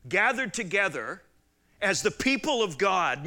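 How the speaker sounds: ordinary delivery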